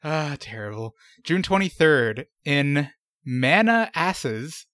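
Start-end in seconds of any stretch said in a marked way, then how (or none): 3.3-4.7 "Manasses" split as MANNA-ASSES, drawn out; mispronounced Manasses. Pretend. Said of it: "do you think that's right?".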